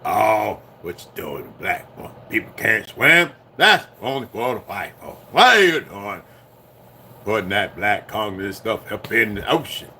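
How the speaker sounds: a hint of the bigoted old cartoon man, mocking; Uncle Ruckus voice. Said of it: "in my Uncle Ruckus voice".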